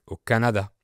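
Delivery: usual speaking voice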